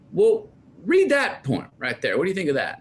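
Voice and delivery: mom pointing at the book, a pushy high voice; imitating mom.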